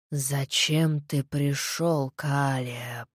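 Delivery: low, soft, very slow hiss; hissing undead voice. Acted. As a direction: hiss it out from the grave. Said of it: "неразборчиво прошипел мертвец".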